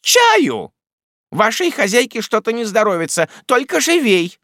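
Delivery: high, loud barked command; imperious order. A narrator's reading. Miss Betsey ordering the maid for tea, imperious. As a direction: command like the mistress of the house. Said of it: "таким тоном, словно она повелевала".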